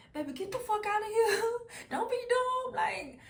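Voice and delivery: cracking up while quoting; laughing, squealing. Retold or imitated. Quoting urgent warning, laughing.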